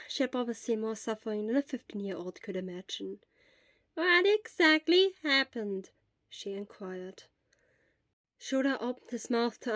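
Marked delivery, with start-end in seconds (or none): 3.9-5.9 slow, higher, lightly voiced old nurse; kindly nurse.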